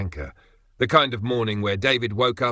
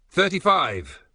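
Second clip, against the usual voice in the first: correcting; 9 semitones higher, 4 dB louder, slower than usual. Acted. Reg correcting his colleague.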